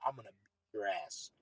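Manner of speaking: very low, hushed menace; barely voiced, as if mouthing it across a green; retold secret threat under the breath; mouthed threat. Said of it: "I mouth to him".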